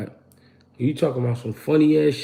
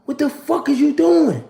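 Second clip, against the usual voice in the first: angry valet; 10 semitones higher than usual. Retold or imitated. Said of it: "He like".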